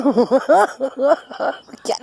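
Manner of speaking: shaky, crying-edged laugh; half laugh, half whimper; nervous laugh. Real, not acted. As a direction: laugh out the fear.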